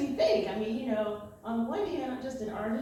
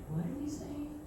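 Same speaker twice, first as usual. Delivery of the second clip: baffled question; 8 semitones higher, 7 dB quieter than usual. Retold or imitated. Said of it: "I'm like".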